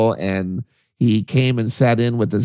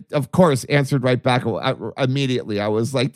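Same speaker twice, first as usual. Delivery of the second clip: ordinary delivery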